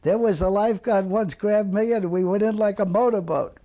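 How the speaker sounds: put-on be the old man boasting years later; imagined old man. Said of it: "he's probably saying".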